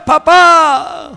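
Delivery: usual speaking voice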